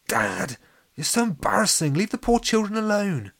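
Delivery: high, whiny protest at dad; embarrassed scolding. A narrator's reading. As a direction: tell off an embarrassing parent.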